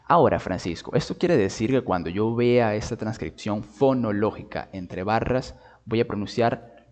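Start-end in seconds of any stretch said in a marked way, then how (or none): none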